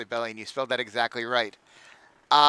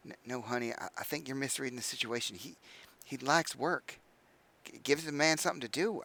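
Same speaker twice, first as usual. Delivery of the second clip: soft, lowered voice, half-whispered reassurance; leaning in to whisper; 8 dB quieter than usual. Acted. Father leans in to his daughter, speaking softly. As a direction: hush it close to the daughter's ear. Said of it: "leans in to whisper to you".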